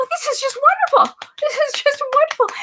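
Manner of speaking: sarcastic high-pitched gushing with a laugh; mocking fawning legislators. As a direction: squeal it like a gushing sycophant.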